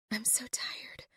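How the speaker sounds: exhausted breathy whisper. A narrator's reading. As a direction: barely voice it, spent. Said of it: "my voice a pathetic whisper".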